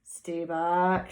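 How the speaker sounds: low gruff squirrel bark; shouting. Acted. Squirrel Bruce warns off Cyril, low and gruff. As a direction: warn him off, low. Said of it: "shouted Bruce".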